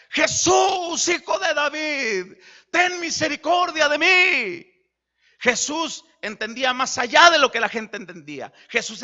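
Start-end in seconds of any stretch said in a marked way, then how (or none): 0.1-4.7 clamor lento y fuerte, más agudo; grito de súplica. Retold or imitated. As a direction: grita pidiendo misericordia desde la orilla del camino. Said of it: "alguien le gritaba". (ciego gritando a Jesús, suplicando a voz en cuello)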